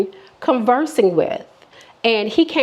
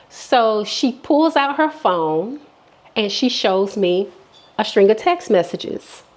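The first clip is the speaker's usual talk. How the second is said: ordinary talk, nothing marked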